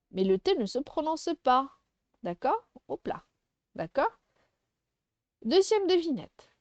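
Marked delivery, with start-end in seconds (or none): none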